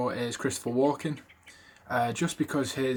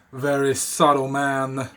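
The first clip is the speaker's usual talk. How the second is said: slow, louder Walken "Very subtle man"; Walken accent; 6 dB louder, slower than usual. Retold or imitated. Imitating Timmy's Walken line, slow Brooklyn accent. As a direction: lean hard into Walken's choppy drawl on "subtle". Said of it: "he's like".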